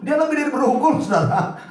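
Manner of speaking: laughing. Real, not acted. Preacher laughs at own joke.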